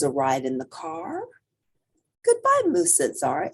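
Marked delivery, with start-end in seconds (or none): 2.2-3.0 bright high call of goodbye; high sing-song goodbye. Acted. Zara calling goodbye to her dog, high sing-song.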